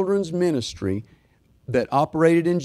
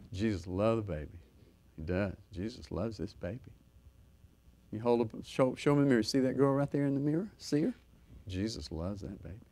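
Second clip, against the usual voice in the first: put-on soft cooing to a baby; baby talk; 3 semitones lower, 8 dB quieter than usual. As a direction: coo to the little one in the mirror.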